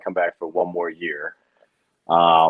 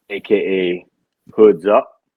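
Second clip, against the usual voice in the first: seductive voice; 2 semitones lower, 4 dB louder, slower than usual. Acted. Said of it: "the seductive voice on when you say it".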